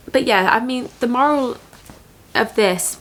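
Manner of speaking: ordinary talk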